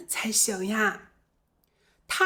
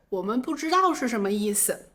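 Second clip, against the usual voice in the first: ordinary delivery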